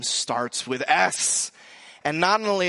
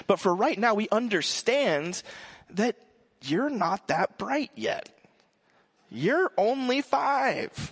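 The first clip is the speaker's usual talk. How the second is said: put-on slow, higher, talking down to a child; mock condescending; 5 semitones higher, slower than usual. Pretend words to the son, slow and condescending. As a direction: patronize him slowly like a toddler. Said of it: "We didn't say that to him".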